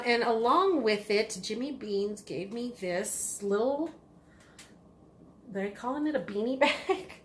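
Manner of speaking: ordinary talk, nothing marked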